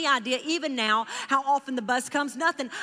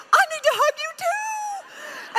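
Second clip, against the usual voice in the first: emotional cry; slower than usual. Retold or imitated. Preacher answering the stranger who asked for a hug, slow and high. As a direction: burst out with feeling.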